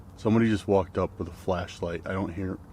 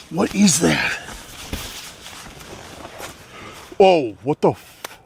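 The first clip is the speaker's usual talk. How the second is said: alarmed; 6 semitones higher, slower than usual. Real, not acted. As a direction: react with rising alarm. Alarmed at something outside.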